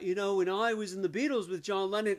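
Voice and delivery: lightly voiced British rocker reminiscing. Comic imitating [name].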